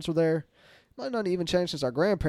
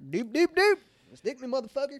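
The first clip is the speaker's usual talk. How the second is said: much higher, sing-song glee, then a cocky dare; mock eager jab; 10 semitones higher than usual. Telling the concert crowd he wants the shot, mock gleeful. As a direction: bounce in ready for the needle.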